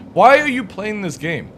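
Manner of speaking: usual speaking voice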